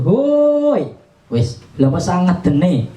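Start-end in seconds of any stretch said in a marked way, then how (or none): 0.1-0.9 test shout. Shown as a demonstration. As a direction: shout to hit the compressor. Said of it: "saya teriak kayak gimana pun". (teriakan tinggi untuk tes)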